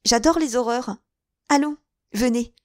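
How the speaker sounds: put-on a giddy young woman relishing the macabre; bright eager exclamation; laughing lightly. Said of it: "elle se mit à rire".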